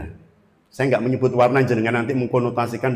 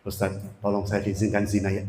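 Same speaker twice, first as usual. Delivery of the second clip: mock petitioner; 5 semitones lower, 7 dB quieter than usual. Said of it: "Dalam tanda petik".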